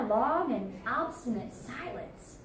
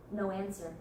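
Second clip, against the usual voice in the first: plain reading voice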